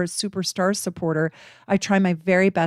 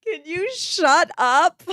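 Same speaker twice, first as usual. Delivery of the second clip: much higher and louder, slow, half laughing; laughing exasperation; 10 semitones higher, 5 dB louder, slower than usual. Retold or imitated. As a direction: throw it back, cracking up at him. Repeats retort, laughing.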